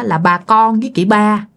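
plain reading voice

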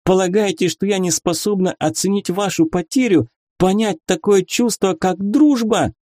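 high, heated reproach; indignant. A narrator's reading. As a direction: fire off offended questions. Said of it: "возмутилась барышня".

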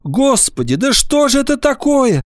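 high-pitched plaintive lament; distressed teacher's complaint. A narrator's reading. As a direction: wail helplessly in a high voice. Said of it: "жалобно сказала Нина Петровна".

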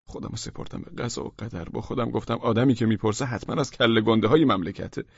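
usual speaking voice

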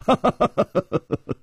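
high staccato chuckle, falling away; performed rippling laughter; acted laugh. A narrator's reading. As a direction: run of light stage ha-has.

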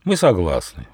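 ordinary delivery, nothing marked

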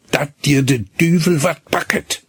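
growled curse. Acted. Hinnerk grumbles a curse passing the couple, mildly voiced. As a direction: mutter a grudging curse sideways. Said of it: "knurrte Hinnerk".

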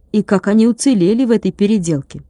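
ordinary delivery, nothing marked

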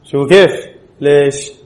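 ordinary talk, nothing marked

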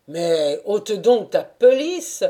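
a hint of a gruff father coaxing; Taras urging. A narrator's reading. Taras telling the dancer to take off his coat, loudly. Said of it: "lui dit enfin Taras".

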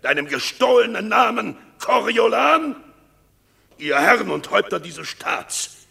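hoch und laut, immer wieder aufschreiend; zornige Anklagerede. Acted. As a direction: den Verräter vor dem Senat wütend bloßstellen. (Aufidius hetzt die Herren schreiend gegen Martius auf)

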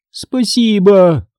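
high, slow, pitiful voice; plaintive. A narrator's reading. Injured teacher thanking the school nurse, plaintive. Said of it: "жалобным голосом откликнулась".

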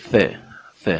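ث as English "th", tongue on upper teeth; isolated lisped ث sound; voiceless th demo. Shown as a demonstration. Teacher models the th sound, ث with tongue at teeth. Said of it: "کافیه نوک زبون".

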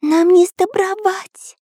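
high, drawn-out little character voice; small high worried voice, slowly; испуганный Карандаш. A narrator's reading. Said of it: "прошептал Карандаш". (испуганный тонкий голосок)

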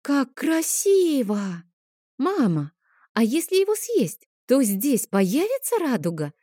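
put-on a small girl enchanted by the rainbow cake; breathless childlike wonder; excited exclamation. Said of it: "воскликнула Адель".

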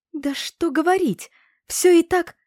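wife losing her temper with the headman; getting heated. A narrator's reading. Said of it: "распалялась Авеса всё больше".

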